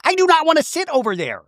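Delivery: high, whiny mock protest; mocking whiny refusal. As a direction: whine it like a stubborn kid. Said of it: "He's like".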